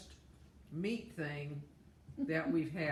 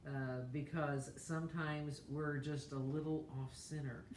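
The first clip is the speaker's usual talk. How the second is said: prayer; 3 semitones lower than usual. Real, not acted. Leads a mealtime prayer.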